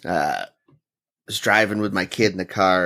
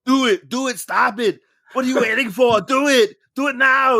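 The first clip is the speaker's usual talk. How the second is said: much higher, barked Austrian commands; Arnold shouting; 3 dB louder than usual. Retold or imitated. Dad yells at his son like Arnold in the grocery store, a much higher Austrian bark. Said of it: "You do it very well".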